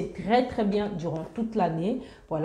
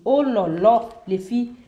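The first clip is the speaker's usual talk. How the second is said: excited oh là là; 3 semitones higher, 6 dB louder than usual. Real, not acted. Delighted at the 3D motif.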